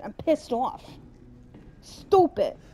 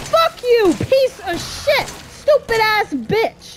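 loud, high angry yelling, slowing at the end; furious shouting; 9 semitones higher, 6 dB louder, slower than usual. Real, not acted. Player cursing at the creature attacking, furious shout. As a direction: spit the insults out in rage.

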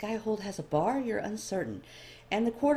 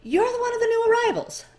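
much higher, louder startled elf voice; 13 dB louder than usual. A narrator's reading. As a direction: jump in surprise, voice shooting up. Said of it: "seemingly startled by your presence".

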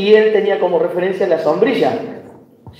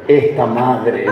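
mock reproach; 7 semitones lower, much slower than usual.

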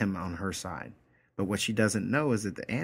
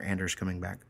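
ordinary talk

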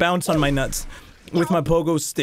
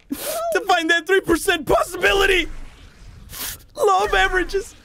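fervent shout; 12 semitones higher than usual. Real, not acted. Pep talk climax.

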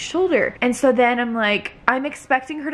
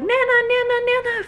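high panicked cry of the name; imitating mom's frantic call, much higher; freaking out. Mom rounding the corner, calling out in a panic. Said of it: "freaking out".

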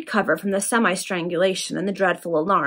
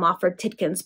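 plain reading voice

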